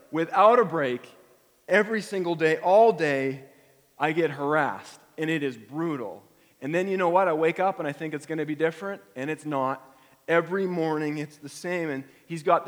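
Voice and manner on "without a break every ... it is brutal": anguished outcry. Acted. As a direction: cry it out, worn down. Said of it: "you can hear his heart".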